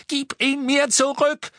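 very high, loud shriek; out of control. Acted. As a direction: demand it back, losing all composure. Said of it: "derart die Beherrschung verlieren sehen".

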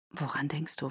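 very soft, low, near-whisper; whispered question. A narrator's reading. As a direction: ask it under your breath, low and gentle. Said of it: "flüsterte er".

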